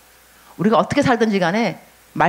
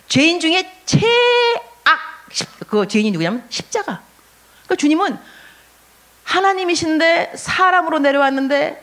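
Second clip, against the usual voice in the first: fervent preacher's rising call; preaching at full force; 7 semitones higher than usual. Real, not acted. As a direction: build it up to the lowest point. Preaching climax, raised pitch.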